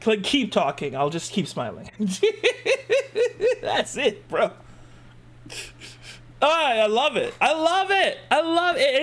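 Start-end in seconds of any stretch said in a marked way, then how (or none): 1.9-3.6 real high, squealing laugh; high-pitched giggle. Real, not acted. Laughing over the accent. 6.4-9.0 high-pitched, excited gushing; gushing delight. Real, not acted. Gushing over the accent.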